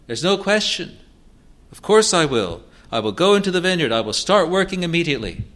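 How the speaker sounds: an overeager son promising his father to work in the vineyard; eager promise. Retold or imitated. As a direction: gush the promise like a too-keen son.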